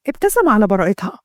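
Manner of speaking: usual speaking voice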